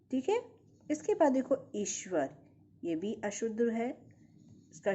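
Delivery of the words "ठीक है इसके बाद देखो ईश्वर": ordinary talk, nothing marked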